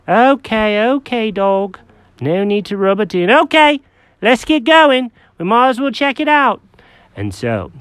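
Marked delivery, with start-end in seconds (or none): 0.1-6.6 high, fussy cat voice; Jenkins the cat. Acted.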